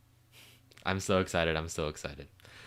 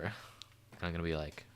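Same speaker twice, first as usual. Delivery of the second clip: ordinary delivery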